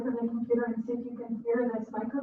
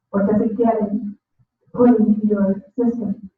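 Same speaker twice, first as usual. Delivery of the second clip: raised voice so she can hear; louder repeat; 5 dB louder than usual. Real, not acted. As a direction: speak up, clearer and louder. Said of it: "I really just can't hear the question".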